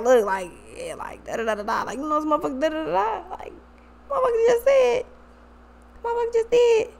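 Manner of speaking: hushed, mumbled gossip rising to a high pitch; mock whispering to a group chat, climbing high; soft, high-pitched tattling voice; imitated gossiping.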